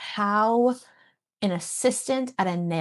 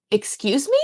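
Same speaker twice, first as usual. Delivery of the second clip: higher, louder mock disbelief; puzzled "excuse me"; 6 semitones higher, 5 dB louder than usual. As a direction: play the baffled beta reader. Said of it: "one of my beta readers was like".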